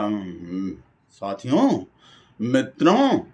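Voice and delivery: lightly mimicked politician's address; drawn-out salutations with a swooping ending; Modi. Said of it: "Modi जी चीनी को daily लाल आंख दिखाते हैं".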